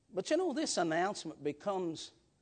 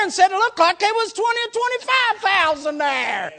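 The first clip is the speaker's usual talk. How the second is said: loud, high, driving preacher voice that keeps climbing; preaching at full force; 10 semitones higher, 14 dB louder than usual. Acted. Preacher telling the congregation about the witnesses, loud and fervent. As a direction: preach the crowd's witness with rising fervour.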